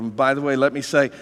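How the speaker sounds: usual speaking voice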